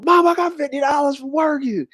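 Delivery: put-on high-pitched boyish boast to mama; excited kid showing off his pay, high voice. Son tells mama about first pay, high and excited.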